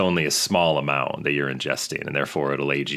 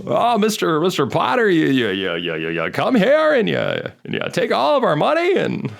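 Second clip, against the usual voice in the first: high-pitched, louder, worked-up stammering impression; Jimmy Stewart; 4 dB louder than usual. Shown as a demonstration. Podcaster playing Stewart confronting Mister Potter, agitated stuttering impression. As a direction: stammer indignantly like an old film hero. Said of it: "It's really good".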